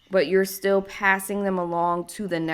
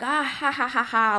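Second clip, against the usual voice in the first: higher-pitched mock "ah-ha-ha-ha-ha" laugh; imitated belly laugh; 5 semitones higher than usual. Imitating a laughing ancestor for listeners, mock belly laugh. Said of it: "doing a big belly laugh".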